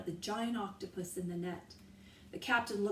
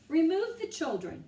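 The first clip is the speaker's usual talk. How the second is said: loud, higher command; roared order; 8 semitones higher, 7 dB louder than usual. A narrator's reading. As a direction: roar the order. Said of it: "the captain roared again".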